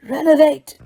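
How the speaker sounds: ordinary delivery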